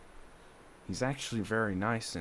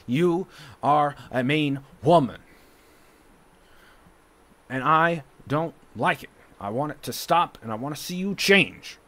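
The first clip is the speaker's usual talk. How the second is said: angry; 4 semitones higher, 8 dB louder than usual. Real, not acted. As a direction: let the anger out, louder. Angry confrontation with mother.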